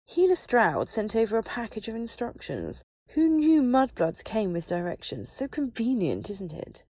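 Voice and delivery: sharp little voice, pitched high; brittle sarcasm. A narrator's reading. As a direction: sneer it brightly with brittle, biting sarcasm. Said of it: "The sarcasm in the witch's sharp little voice was brittle".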